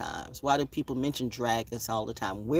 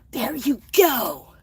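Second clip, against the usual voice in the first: excited gasp; 11 semitones higher, 6 dB louder, slower than usual. Real, not acted. Spotting a bird, excited.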